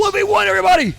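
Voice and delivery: very high, loud holler; mock crowd call. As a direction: holler it to gather the whole park. Mimics calling crowd to match.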